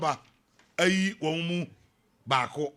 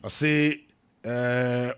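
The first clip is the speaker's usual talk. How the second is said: ordinary talk, nothing marked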